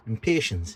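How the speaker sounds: impatiens said im-PAY-shunz; alternative pronunciation. Real, not acted. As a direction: say impatiens like patience, with a long a. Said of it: "whatever you wanna call it".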